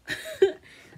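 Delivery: sudden high laugh; high burst of laughter. Real, not acted. Laughs after whisper.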